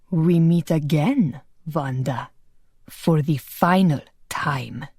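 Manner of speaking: low, slow menacing villain. Acted. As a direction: savour the threat slowly, deep and cold. Villain threatens Wanda at swordpoint.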